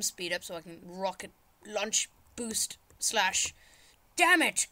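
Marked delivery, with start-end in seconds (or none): 4.2-4.7 high, loud burst of annoyance; frustrated outburst. Real, not acted. Player curses a failed rocket boost, frustrated. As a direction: snap it out as the attempt fails. Fails in game.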